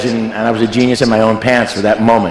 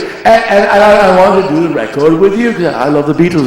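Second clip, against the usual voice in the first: [name] impression; 5 semitones higher than usual. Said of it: "He goes".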